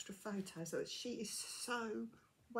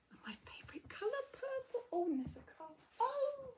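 much higher, squealing at her favourite colour; excited squeal. Real, not acted. Spotting purple in the parcel, high surprised squeal.

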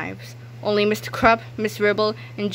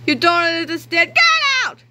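high, loud furious yell; angry scream; 8 semitones higher, 5 dB louder than usual. Acted. Krupp throwing the boys out, loud angry scream. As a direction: blast the boys away. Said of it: "Mister Krupp screamed".

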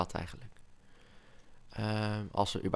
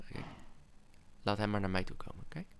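ordinary delivery

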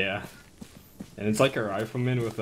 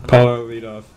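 loud call to a teammate; 10 dB louder than usual. Real, not acted. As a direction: call his name out sharp. Calling teammate to lead stack.

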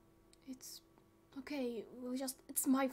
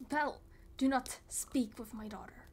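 ordinary talk

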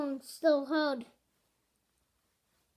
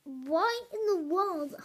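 louder, higher demanding question; urgent raised demand; insistent; 4 semitones higher, 4 dB louder than usual. Acted. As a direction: press her hard for an answer. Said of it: "I insisted".

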